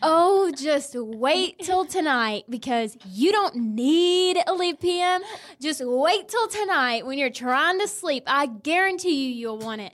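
mock warning. Retelling a taunt about trying to sleep, mock warning. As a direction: tease with exaggerated, swooping certainty. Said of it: "And I, I grabbed that and I said".

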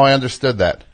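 ordinary delivery

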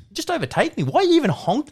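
loud, high-pitched mock protest; incredulous. Driver protesting being honked at, high-pitched disbelief. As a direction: push it up high, baffled and exasperated. Retold reaction to honking truck driver.

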